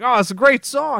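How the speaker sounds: high-pitched mock delight at a song; acted enthusiasm. Film character hearing a song on the radio, mock enthusiasm. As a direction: imitate someone loving what's on the radio. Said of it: "and he's like".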